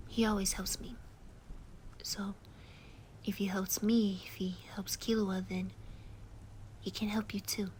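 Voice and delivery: hushed, tender soothing to a friend in tears; calm them down gently, mostly whispered; comforting whisper. Acted.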